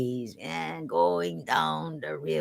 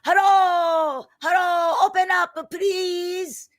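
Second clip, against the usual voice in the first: high, loud pleading shout; yelling louder; 7 dB louder, slower than usual. Acted. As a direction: shout up to be let in. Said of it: "Isunboshi yelled more loud".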